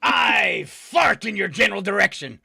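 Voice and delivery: loud, hammed-up comic taunt; Monty Python. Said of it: "in my best Monty Python voice".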